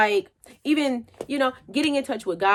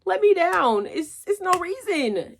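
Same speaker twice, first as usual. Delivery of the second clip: begging; 7 semitones higher, slower than usual. Retold or imitated. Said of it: "I said".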